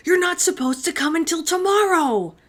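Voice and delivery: high, loud panicked shriek. A narrator's reading. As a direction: shriek it in alarm. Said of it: "Herschel shrieked".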